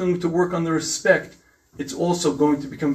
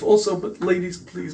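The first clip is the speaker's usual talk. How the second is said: ordinary talk, nothing marked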